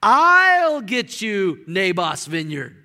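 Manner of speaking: loud and slow, each word weighed; loud, slow declaration. Retold or imitated. Queen to her husband, slow and loud. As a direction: announce it like taking charge. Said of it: "Watch this".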